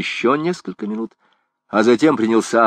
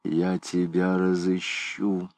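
muttered through teeth; 7 dB quieter, slower than usual. A narrator's reading. Departing archdeacon warns Gringoire, muttering through clenched teeth. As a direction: mutter it darkly under your breath. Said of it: "пробормотал сквозь зубы".

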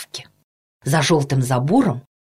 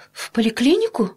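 higher, taken-aback question; flustered mother; 7 semitones higher than usual. A narrator's reading. Mother hearing she must bring her son to the clinic, flustered. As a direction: ask it as a bewildered mother. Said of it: "растерялась мама".

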